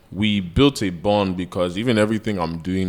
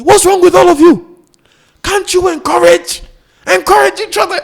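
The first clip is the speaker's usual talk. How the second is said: mock African accent, much higher and louder, pleading; imitating a friend's African accent, scolding the team to encourage; Joe's African accent; 12 dB louder than usual. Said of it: "the way he said with the African accent".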